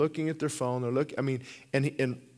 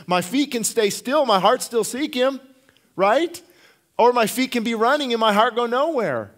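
louder and higher, pleading with the crowd; full-force preaching; 8 semitones higher, 8 dB louder than usual. Acted. Pastor urging the congregation to seek God, preaching at full force. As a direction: pour it out, building to a cry.

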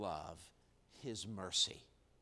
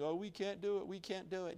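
higher, whining "we can't do it"; a hammed-up whine, pitched higher; mock moaning; 6 semitones higher than usual. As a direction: imitate a crowd groaning in self-pity. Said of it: "moaning and crying and groaning".

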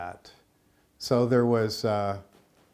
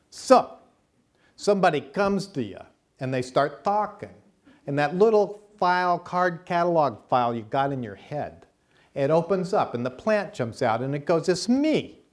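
high, loud Chicago accent impression; 7 semitones higher, 6 dB louder than usual. Herbalist from Chicago explaining plants to listeners, loud Chicago accent. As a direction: do Rosita's brassy Chicago accent. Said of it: "So she talks like this".

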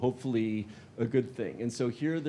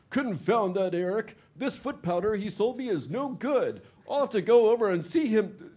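louder, higher cranky grumble; angry complaint; 9 semitones higher, 5 dB louder than usual. Acted. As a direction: fume about the useless foot powder. Angry customer about foot powder.

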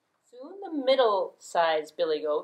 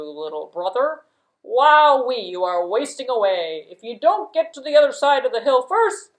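big goat voice; 3 semitones higher, 10 dB louder than usual. Acted. Big goat coaxing little brother, loud sly goat voice.

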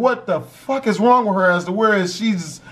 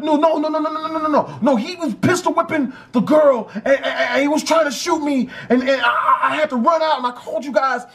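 higher, stammering mock panic; flustered explaining; 5 semitones higher, faster than usual. Brother insisting to police he was shot at, flustered. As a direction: rattle off the no's, then stumble through it.

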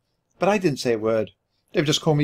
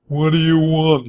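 gruff grumble, raised and changed voice; grumbling; 4 semitones higher, 4 dB louder than usual. A narrator's reading. Hunchback answering the knock at the door, grumbling. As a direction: grumble it like a surly servant. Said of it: "he grumbled".

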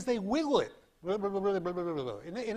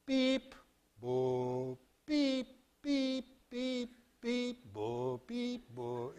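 scanner beeps; 6 semitones higher, much slower than usual. Shown as a demonstration. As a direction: mimic a scanner reading scattered lines. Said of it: "It sees".

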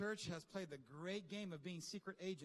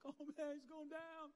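mock excited fan; 8 semitones higher, 5 dB quieter than usual. Imitating excited fight fan.

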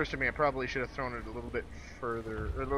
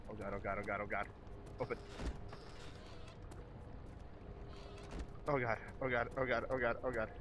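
being chased; 2 semitones lower, 9 dB quieter, faster than usual. Real, not acted. Fleeing the monster.

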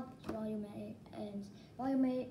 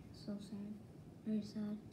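sad; 3 semitones lower, 9 dB quieter than usual. Real, not acted. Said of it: "I don't wanna cry".

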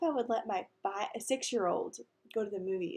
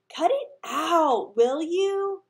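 high, loud, drawn-out protest; exasperated shout; 8 semitones higher, 11 dB louder, much slower than usual. A narrator's reading. Peter shouts down friends' wedding teasing, exasperated. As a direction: snap loudly at the teasing, stretching it out.

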